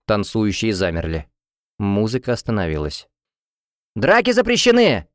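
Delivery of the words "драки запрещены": high-pitched alarmed cry; young waiter's shout. A narrator's reading. Young waiter to brawling students, shouting. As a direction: call out to stop a fight. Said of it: "закричал молодой парнишка-официант".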